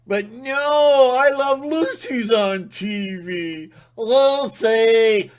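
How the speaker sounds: high, loud, silly voice with a shrieking laugh; mocking sitcom fan. Mocking a viewer lost in I Love Lucy, shrill and silly. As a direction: ham up a TV-dazed fool.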